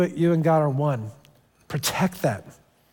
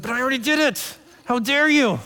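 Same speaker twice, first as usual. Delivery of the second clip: put-on higher-pitched childish indignation; indignant whine; 7 semitones higher than usual. Believer protesting to God, a high indignant whine. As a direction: sound wounded and entitled.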